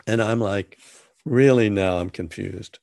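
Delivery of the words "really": surprised really. Retold or imitated. Reacting to Jack's instruction.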